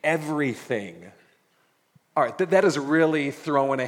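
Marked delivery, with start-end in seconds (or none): 0.0-1.1 slow pleading servant, a touch louder; begging servant. Acted. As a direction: plead for time, dragging the words. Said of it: "he begged".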